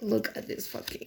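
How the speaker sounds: whispered under the breath in disbelief; disgusted whisper. Real, not acted. Reviewer whispering to viewers over a flimsy card, disgusted.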